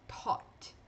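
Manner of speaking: "Taught" whispered, open "aw", stopped t; whispered model. Shown as a demonstration. Teacher whispers "Taught" for students to repeat. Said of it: "Pronuncia aí na sua casa".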